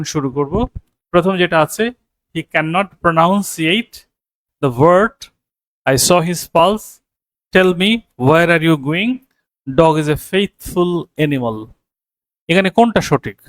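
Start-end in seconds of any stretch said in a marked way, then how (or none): 2.3-11.6 reading each sentence very slowly and clearly; slow reading of options. Shown as a demonstration. Teacher reading quiz options slowly to the class. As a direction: dictate the options word by word. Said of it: "নিচের কোন বাক্যটি শুদ্ধ".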